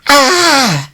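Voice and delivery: piercing high scream of terror; terrified scream. Retold or imitated. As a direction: scream as if pushed off a cliff. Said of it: "screamed like I had pushed him".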